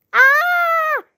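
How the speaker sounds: long, high "ah" held on one note; high acted yelp, drawn out; shouted cry. A narrator's reading. Emily as her tooth flies out, high shouted cry. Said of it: "Emily shouted".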